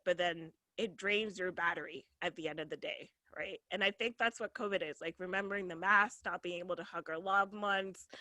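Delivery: soft, low, heartfelt appeal; sincere, tender. Real, not acted. Comedian leaving the audience with care, softly sincere. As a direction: drop the volume and pitch, speak earnestly. Sincere closing message after the jokes.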